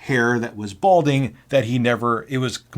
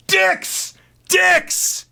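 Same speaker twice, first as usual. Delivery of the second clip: very high-pitched short screams; screaming; 4 dB louder than usual. Acted. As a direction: scream it across a warehouse. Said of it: "screaming in the warehouse".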